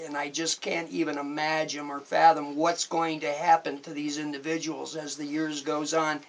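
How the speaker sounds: ordinary delivery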